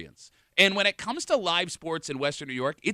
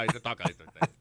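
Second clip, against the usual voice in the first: ordinary talk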